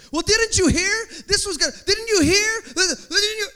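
high, loud, breathless insisting; frantic gossip. Retold or imitated. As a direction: push the rumor in a panic. Rumor-spreader insists frantically.

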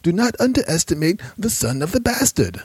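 high, urgent plea from a sick man; urgent dying man. Acted. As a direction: press him hard to kill.

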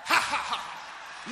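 slow, breath-heavy ha-ha laugh; deliberate laugh. Shown as a demonstration. Preacher showing congregation how to laugh, deliberate laugh. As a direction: demonstrate a spaced-out belly laugh. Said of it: "Come on, laugh right now".

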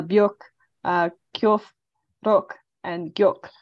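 "byok", "kyofu", "rok", "gyok" said with the final vowel cut short; clipped final vowels. Shown as a demonstration. Interviewer demonstrating the clipped rhyme endings. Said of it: "sharpen the final sound of words".

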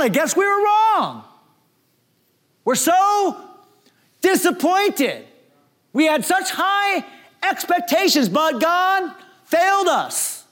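voiced high and pleading, slowing into disappointment; disappointed disciples. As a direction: speak as a crushed follower whose hopes fell apart. Disappointed disciples retelling their loss.

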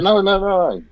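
high, insistent no no no; squeaky protesting denial; mock denial. Suspect denying the weapon, high mock protest. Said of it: "They'd be like".

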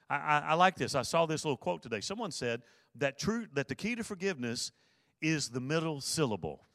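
normal talking voice

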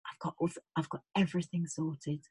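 hushed, low murmur close to her ear; very soft and intimate, voice dropped; whispered reassurance. Retold or imitated. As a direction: imitate a dad's soft, private reassurance. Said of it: "he came and whispered something".

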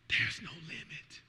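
"There's no limit" slow, low and soft; hushed. Real, not acted. Pastor hushing to church, slow soft low. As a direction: drop to a hush on "There's no limit".

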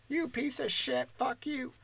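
high-pitched, softened heckle; mock distant yell. Passerby heckling the open mic from below, mock yell. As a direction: imitate a passerby hollering from far off. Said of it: "can yell at us from the street".

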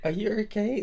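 high-pitched, hesitant worried check; retold concern. Checking on a friend jolted in the seat, retold concern. Retells worrying about the other speaker.

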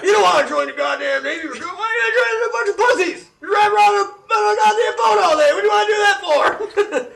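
drill sergeant rant. Retold or imitated. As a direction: rant at the kid like a gung-ho recruiter. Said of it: "he goes".

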